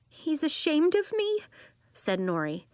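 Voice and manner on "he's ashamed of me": put-on high, hurt little-girl voice; mildly tearful, pitched high; tearful child. A narrator's reading. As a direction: say it wounded, tears coming. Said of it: "Her eyes teared up".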